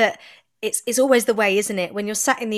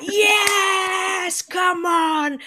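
high, drawn-out rooting yell; audience cheer; 11 semitones higher, much slower than usual. Retold or imitated. Imitating an audience cheering a friend on stage, high cheering yell. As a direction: whoop them on like an adoring crowd. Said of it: "everyone would just be like".

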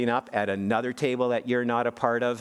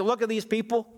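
self-righteous; 9 semitones higher than usual. Retold or imitated. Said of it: "all self-righteously and saying".

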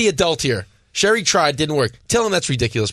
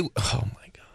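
exasperated whisper; 6 semitones lower, 11 dB quieter than usual. Real, not acted. Friend mocks the baby name.

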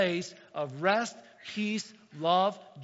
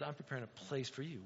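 hushed God promise; 5 semitones lower, 13 dB quieter than usual. Acted. God's promise, voiced softly.

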